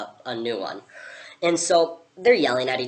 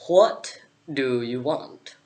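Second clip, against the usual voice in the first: slow, drawn-out caterpillar voice; much slower than usual. Acted. Vaping caterpillar questions a stranger in the forest, slow caterpillar voice. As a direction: draw each word out like a lazy caterpillar.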